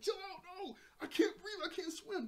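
high, frantic mock panic; hammed-up drowning protest; panicked pleading. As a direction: plead as if being dunked underwater. Luffy being dunked in the water.

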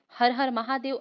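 ordinary delivery

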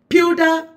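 Pewter high, with PEW punched. Shown as a demonstration. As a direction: hit PEW in Pewter, drop the ending. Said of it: "It's louder".

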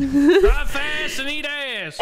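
high, loud southern holler; hammed-up southern accent. Parody southern catchphrases, shouted.

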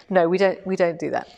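ordinary talk, nothing marked

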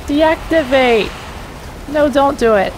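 frantic high panic; panicked. Real, not acted. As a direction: beg the door in real alarm. Panicking as water floods in.

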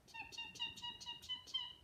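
tiny high peeping chick; high soft chick cheeps; chick peeps. Acted. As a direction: peep high and small like baby chicks. Imitating chicks for toddler.

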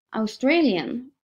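put-on slow, drawn-out American accent. A narrator's reading. Man at airport asks narrator's origin, American accent. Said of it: "frågar han med amerikansk accent".

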